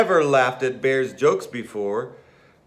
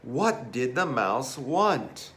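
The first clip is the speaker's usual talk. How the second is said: plain reading voice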